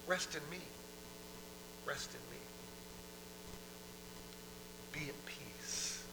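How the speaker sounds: soft, dropping toward a whisper; hushed soothing God. Acted. God offering rest to the weary, hushed reassurance. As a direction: settle them with a hush.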